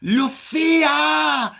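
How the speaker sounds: high, loud, slow drawn-out name; long echoing call; drawn-out shout. Retold or imitated. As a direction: stretch the name out in a calling yell. Surprise on seeing her.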